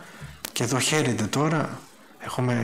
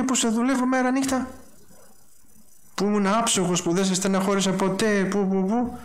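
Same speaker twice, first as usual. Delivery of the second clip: high, whining complaint from the jealous son; elder son whining to his father about the feast; aggrieved elder son; 8 semitones higher than usual. Acted. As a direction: protest like a wronged son who was never thanked. Elder son lists his merits.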